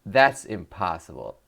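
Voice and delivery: normal talking voice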